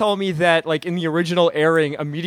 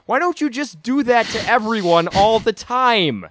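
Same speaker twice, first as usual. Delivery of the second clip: exasperated; 6 semitones higher, 3 dB louder than usual. Real, not acted. Exasperated rhetorical question to Zed.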